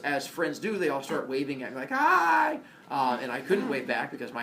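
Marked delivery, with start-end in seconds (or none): 1.9-2.6 cheery hi. Retold or imitated. Friends waving from windows.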